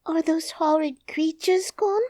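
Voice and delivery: high frightened little princess voice. Acted. Princess asks servants, high and fearful.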